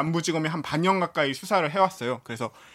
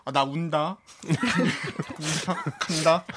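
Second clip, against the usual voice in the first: laughing; slower than usual. Retold or imitated. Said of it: "현장에서 본 기자의 말로는".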